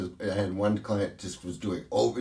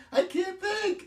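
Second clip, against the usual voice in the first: much higher, imitating a blissed-out colleague; mock giddy bliss; slower than usual. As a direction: squeal it like you can't stop laughing. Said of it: "laughing hysterically".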